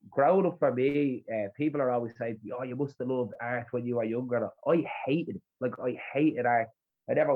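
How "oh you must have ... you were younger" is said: normal talking voice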